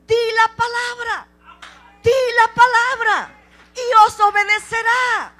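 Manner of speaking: loud, high proclamation from the pulpit; preaching at full force. Acted. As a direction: ring it out over the congregation. Preacher proclaims God's word. (predicando a toda voz)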